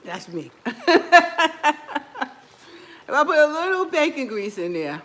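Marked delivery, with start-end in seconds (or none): 0.6-2.3 hearty laugh. Real, not acted. Laughs at own idea.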